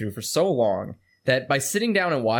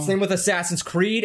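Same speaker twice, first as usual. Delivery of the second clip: normal talking voice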